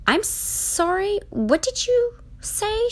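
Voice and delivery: very high, slow, incredulous question; high-pitched, drawn-out shock; shocked disbelief. A narrator's reading. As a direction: stretch it out in squeaky disbelief. Shocked Charlie asks him to repeat.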